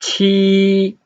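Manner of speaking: Qi held long, high and level; level Mandarin tone on Qi, no fall; first tone. Shown as a demonstration. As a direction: hold Qi on one steady high note. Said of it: "first tone".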